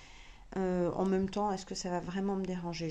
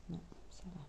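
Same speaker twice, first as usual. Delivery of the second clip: whispered aside; 3 semitones lower, 12 dB quieter, faster than usual. Real, not acted. Reassuring herself while sewing.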